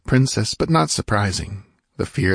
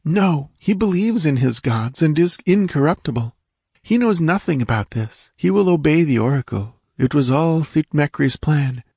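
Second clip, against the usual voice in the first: put-on high, anxious girl's voice; frightened dancing girl; 8 semitones higher than usual. A narrator's reading. Captive girl explains to Conan, high and anxious. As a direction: tell it quickly, still scared.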